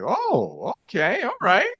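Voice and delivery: squeaky high, pleasantly surprised; high impressed oh okay. Retold or imitated. Learning she's from Zambia, high surprised squeak. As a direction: pitch leaps up, taken aback.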